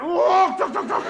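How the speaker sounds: high, loud, sing-song calls of walk and duck; comic high-pitched chanting of walk and duck; high mock shouted walk and duck calls. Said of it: "So I went".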